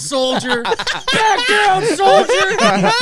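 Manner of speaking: loud, high barked military order; drill sergeant. Acted. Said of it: "drill sergeant scene".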